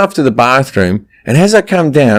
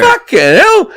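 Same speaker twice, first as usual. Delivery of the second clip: high-pitched incredulous outburst; mock shock; slower than usual. Husband overhearing his wife, mock shock. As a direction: squeal it in mock disbelief.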